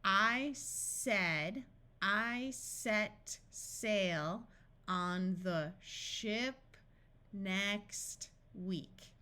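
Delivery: very slow, drawn-out words; deliberate, spaced-out practice reading. Shown as a demonstration. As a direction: separate each word for a beginning reader. Practice sentence read word by word.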